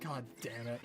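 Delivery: resigned mutter. Retold or imitated. Retold resigned reply.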